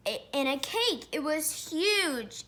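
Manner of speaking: ordinary delivery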